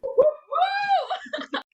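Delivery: rowdy party whoop; hooting and hollering. Acted. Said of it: "Frankie hooted and hollered".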